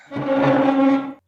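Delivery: high, loud frustrated wail; anguished cry. Acted. Lonely husband lamenting his luck, high loud wail. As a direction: wail it to the sky.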